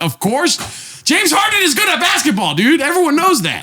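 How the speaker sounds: very high-pitched incredulous yell; exasperated outburst. Acted. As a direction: blurt it out, voice shooting way up. Acted exasperated outburst at obvious remark.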